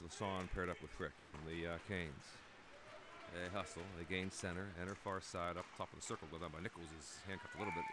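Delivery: brisk running hockey commentary; live play-by-play. Acted. Commentator calling the game for viewers, brisk play-by-play. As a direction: call the action as it happens.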